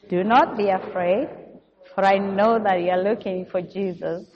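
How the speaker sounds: ordinary delivery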